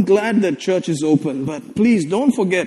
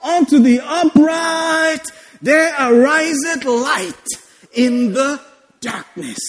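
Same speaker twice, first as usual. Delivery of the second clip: declaimed scripture; 6 semitones higher, slower than usual. Acted. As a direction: stretch the verse out, then hush the ending.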